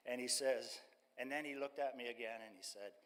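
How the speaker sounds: normal talking voice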